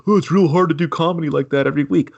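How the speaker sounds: mocking whine. Ranter imitating the show's defenders, high mocking voice. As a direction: parrot their excuse in a put-on voice. Mimicking excuses of comedy defenders.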